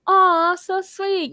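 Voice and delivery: slow, high, drawn-out "aw"; gushing aw. Retold or imitated. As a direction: coo over the couple, mock-sweet. Narrator gushes over the kiss.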